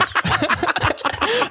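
embarrassed high giggle; high laugh. Real, not acted. Laughing at a roast.

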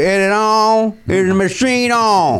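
put-on slow, halting old man voice; lightly mocking a confused senator; old senator impression.

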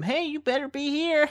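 high, squeaky-pitched pretend nagging; mock motivational bottle. Water bottle urges its owner on, mock bossy. Bottle's time markings given a voice.

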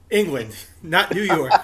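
laughing through the correction; amused, words broken by a laugh. Real, not acted. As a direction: chuckle out the punchline. Joking correction while laughing.

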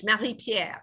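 Marie Pierre said in French, with a French R; French pronunciation. Shown as a demonstration. Guest shows host how her sister's name sounds, French accent. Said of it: "It sounds much better in French".

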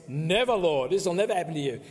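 a forceful Peter stressing never; emphatic never. Retold or imitated. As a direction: hit both nevers hard. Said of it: "you notice, are emphatic".

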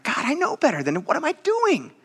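high, exasperated plea; anguished self-reproach. Acted. Drinker regretting a six pack before God, anguished high plea.

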